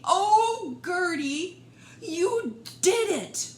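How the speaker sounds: loud, high cheer. Acted. The herd cheering Gertie home, loud and high. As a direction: cheer her home proudly. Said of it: "cheering loudly".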